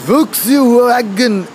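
put-on very slow, high Japanese accent; mock Japanese accent. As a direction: stretch it out in a hammy Japanese accent. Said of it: "say anything in a Japanese accent".

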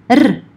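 R rolled as a Scottish trill; R trilled, as in Scottish English. Shown as a demonstration. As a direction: roll the R on the tongue tip. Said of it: "can actually be trilled".

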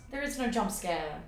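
ordinary talk

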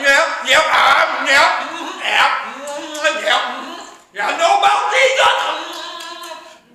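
loud, exaggerated gum-chewing talk; mock someone talking round a wad of gum; gum chewer. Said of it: "Someone's chewing gum".

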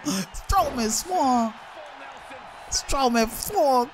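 excited shout. Real, not acted. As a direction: stretch the words out in a hyped yell. Hyped reaction to a reversal.

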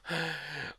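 a soft, high, breathy little laugh; high airy chuckle. Acted. Svidrigailov amused by Raskolnikov's outrage, a soft high chuckle.

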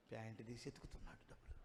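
fast hushed whisper; sneaky whisper. Retold or imitated. Narrating a thief sneaking cash from a sleeper, in a fast whisper.

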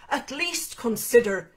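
slow, loud, impatient urging; losing patience. Acted. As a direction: press her hard to give in. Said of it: "sounding less patient now".

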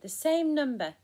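loud, high cry of excitement; excited shout. A narrator's reading. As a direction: call it out, thrilled. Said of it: "shouted Spinderella".

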